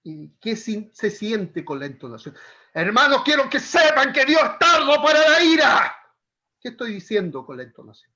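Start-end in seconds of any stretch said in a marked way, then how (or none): 2.7-6.0 high-pitched, very loud angry shout; shouted scolding. Shown as a demonstration. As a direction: scold the congregation at full volume. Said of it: "estoy gritando, estoy retando". (regaño a gritos, airado)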